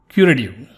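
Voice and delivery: CURATIVE with the T softened toward a D; soft T. Shown as a demonstration. Teacher showing learners American words, curative with a soft D-like T. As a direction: tap the T lightly in curative. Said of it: "sometimes the T is not pronounced".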